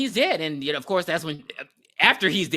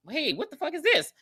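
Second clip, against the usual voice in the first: startled; 10 semitones higher than usual. Retold or imitated. Girl finds doll foot.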